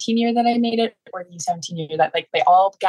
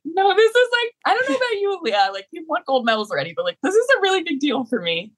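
high, pleading mock sobbing; tearful protest; 10 semitones higher, faster than usual. Player retelling crying to a teammate after making the team, high and tearful. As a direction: protest through tears, pitched up high.

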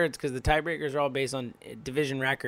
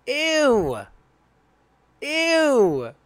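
high, loud grossed-out ew; disgusted ew; 11 semitones higher, 6 dB louder than usual. Real, not acted. Fan groaning at the team's collapse, disgusted ew. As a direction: recoil from something gross. Reacting to the losing streak.

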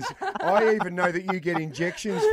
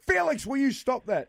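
scolding mum; 10 semitones higher than usual. Retold or imitated. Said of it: "I'll go".

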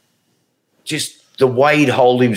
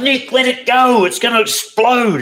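much higher, slow, urgent plea; alarmed pleading; 14 semitones higher than usual. Retold or imitated. Mates warning Nick the foreskin will explode, urgently. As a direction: beg him to stop before it bursts. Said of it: "we'd be going".

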